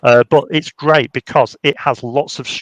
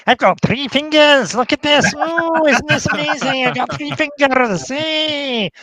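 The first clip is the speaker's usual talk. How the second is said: put-on much higher, excited, childlike puppet voice; Sylvester impression; 13 semitones higher than usual. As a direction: play an amazed little puppet showing off his fingers. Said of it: "that's how Sylvester sounds".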